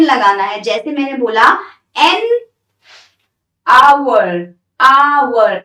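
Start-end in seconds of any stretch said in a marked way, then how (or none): 3.7-5.6 आवर said with a silent H, opening on a long "aa"; loud, drawn-out model of आवर, starting on the vowel; demonstrating pronunciation. Shown as a demonstration. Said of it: "इसकी देखो pronunciation क्या होती है". (आवर का उच्चारण, लंबे "आ" से शुरू)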